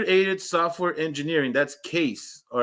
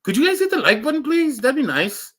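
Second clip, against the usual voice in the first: much higher, fast, sugary request; sweet asking; 8 semitones higher than usual. Shown as a demonstration. Correcting himself to viewers, high sweet plea. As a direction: ask nicely, high and quick. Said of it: "What are you doing?".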